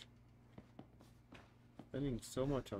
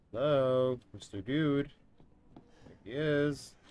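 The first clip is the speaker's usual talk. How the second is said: mock greeting; 4 semitones higher, 7 dB louder, much slower than usual. Real, not acted. Taunting the monster from hiding.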